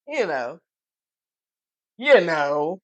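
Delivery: low, loose street "You know". Shown as a demonstration.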